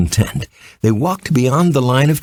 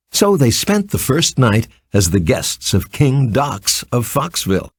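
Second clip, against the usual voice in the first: ordinary delivery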